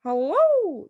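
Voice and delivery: high, loud parrot squawk of hello; squawking parrot calling out. Acted. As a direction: call down the chimney like a parrot. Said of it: "the bird called down to her".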